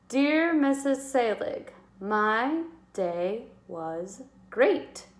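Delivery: "great" drawn out very slowly; teacher rereading the sentence slowly; slow dictation read. Shown as a demonstration. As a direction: spell it out word by word. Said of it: "I need to fill in my last word".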